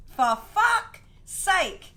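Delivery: loud, slow, exasperated groan; exasperated rant. Real, not acted. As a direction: draw it out in disgust. Ranting about emissions.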